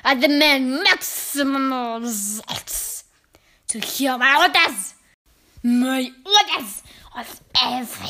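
slow, loud, drawn-out witch command. A narrator's reading. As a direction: relish each word as a menacing tyrant. Witch gives furious orders.

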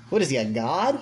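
normal talking voice

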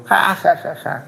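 mock Satan cackle, lightly done; mocking devil laugh. Satan laughs to God at fighting humans, mocking cackle. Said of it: "riéndose con todas las tonterías".

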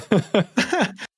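a short high laugh. Real, not acted. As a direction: laugh at the teasing. Laughs at partner's reply.

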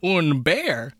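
high, loud goofy bear voice; goofy joke-telling bear, hammed up; Fozzie Bear. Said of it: "Fozzie comes in, and he's like".

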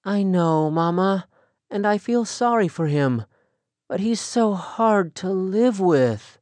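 tearful daughter, understated; lightly voiced daughter. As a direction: admit it reluctantly. Rachel after crying.

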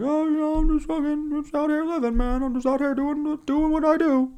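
very high, spaced-out voice; mock homeless guy, high and dazed. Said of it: "Homeless guy's like".

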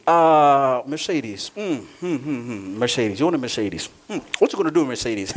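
low, pondering hums and questions; God thinking it over. Acted. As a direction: mull over a doubtful request, pitched well down. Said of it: "God is thinking, right?".

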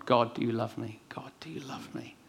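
barely voiced, pleading whisper to God; whispered prayer. Preacher retelling his all-night prayer, in a whisper. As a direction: breathe the plea almost silently.

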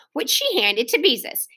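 usual speaking voice

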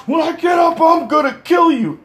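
high, strained mock shout; imitating a furious fallen man; angry threat.

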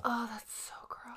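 soft whispered revulsion; whispered disgust. Real, not acted. Listener whispers at the vomit story. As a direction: breathe out the disgust.

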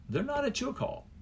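NOT lifted high and sharp; stress on NOT. Real, not acted. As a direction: hit not hard and high.

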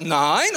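low NEIN with a rising end; demonstrated intonation. Shown as a demonstration. Owner telling the dog no, "Nein" low and rising. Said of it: "Das heißt, du sagst".

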